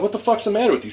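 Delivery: high-pitched, indignant demand; retold angry yell. Motorcyclist yelling at wagon-pulling woman, indignant retold yell. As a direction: act out yelling at her, voice pitched right up. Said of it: "yeah, I yelled at her".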